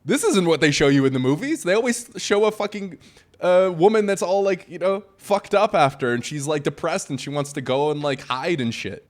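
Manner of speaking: high, loud, worked-up disbelief; incredulous protest. Retold or imitated. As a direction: retell it as if totally baffled. Retold disbelief at what he saw.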